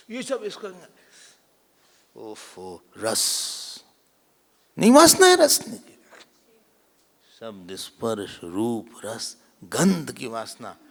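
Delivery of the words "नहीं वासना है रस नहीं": high, loud burst of insistence; emphatic correction. Real, not acted. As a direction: cut in loudly to set it straight. Teacher forcefully correcting a point.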